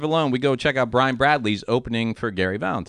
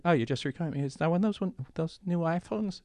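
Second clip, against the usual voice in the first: soft, higher, innocent-sounding questions; feigned friendly interest; 5 semitones higher, 10 dB quieter than usual. Retold or imitated. Comic to man with new phone, feigned friendly interest. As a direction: play it softly curious.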